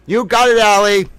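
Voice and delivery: ordinary talk, nothing marked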